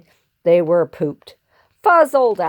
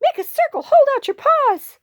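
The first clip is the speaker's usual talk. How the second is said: very high, squeaky orders; rally them in a tiny urgent voice; urgent yelling. Acted. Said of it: "yelled Pip".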